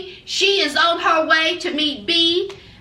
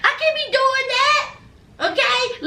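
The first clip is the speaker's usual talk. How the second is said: higher mock-indignant nurse mom; mama's high protest; 8 semitones higher than usual. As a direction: pitch up, a mother flatly refusing. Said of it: "That mama said".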